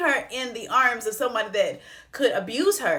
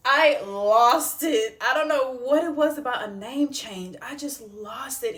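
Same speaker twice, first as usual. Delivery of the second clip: emotional, near tears; 3 semitones higher than usual. Real, not acted. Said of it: "I'm just getting so emotional".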